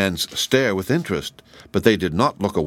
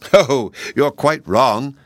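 laughing; 5 semitones higher, 5 dB louder, slower than usual. A narrator's reading.

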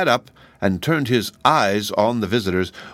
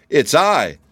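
high, raised cry; pitched-up, eager call; 7 semitones higher, 5 dB louder than usual. A narrator's reading. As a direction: announce yourself loudly. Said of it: "cried the Tin Twins together".

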